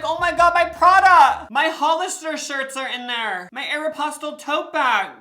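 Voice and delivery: hammed-up crying girl with her Prada gone; mock distraught rich girl. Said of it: "She's like".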